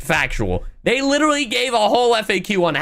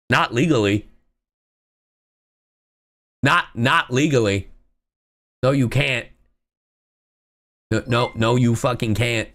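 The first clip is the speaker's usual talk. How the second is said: deadpan rebuttal; 11 semitones lower than usual. Retold or imitated. Retelling a rebuttal to rage baiters.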